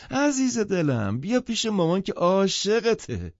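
put-on high, gushing call to a child; doting mother. A narrator's reading. Mother opens her arms to her little girl, gushing. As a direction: coo it loudly, stretching the endearment.

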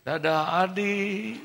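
high, sing-song bye-bye; mock goodbye. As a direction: wave him off teasingly. Teacher waves bye from bus. (dadah dengan nada tinggi mengejek)

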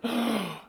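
sharp high gasp of shock; startled gasp. Retold or imitated. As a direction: gasp as if seeing an army. Servant sees the army.

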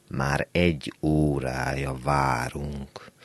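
put-on slow, sleepy old man's grumble; lightly voiced grouchy old man; grumbling old man. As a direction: mutter it like a tired old man at a bus stop. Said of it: "morgolódott az álmos öreg".

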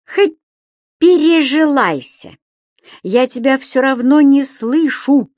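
громко и высоко, с вызовом; перекричать, зажав уши; кричит, заткнув уши. A narrator's reading. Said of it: "сказал Субастик, который успел крепко заткнуть себе уши". (Субастик кричит с заткнутыми ушами, громко и выше)

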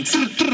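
quick rattling "trr, trr" of an air wrench; imitated wrench noise. Worker mimes the automatic air wrench at work, imitated whirr. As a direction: mimic the pneumatic wrench in two short bursts.

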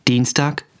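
normal talking voice

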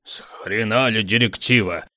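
indignant snort rising loud. A narrator's reading. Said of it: "фыркнул Тощий".

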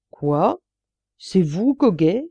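usual speaking voice

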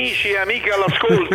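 high, booming announcer; radio announcer voice. Pretend. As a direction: announce it like an old radio host. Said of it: "Facciamo la voce".

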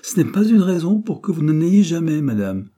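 loud, high-pitched overruling tone; bossy, insistent contradiction; authoritative. A narrator's reading. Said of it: "d'un ton d'autorité".

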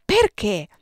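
bristling retort; snapping back. Acted. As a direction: snap the question back at her. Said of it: "Io scattai".

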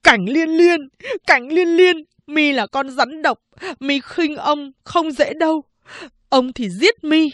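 tearful shouted threat at the snake; enraged yelling. Acted. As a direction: scream her name, then threaten. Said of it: "giơ cây lau lên chửi".

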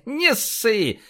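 high chuckle into a cheeky reassurance; laugh, then toss it out through laughter. A narrator's reading. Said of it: "рассмеялся полицейский". (сквозь смех)

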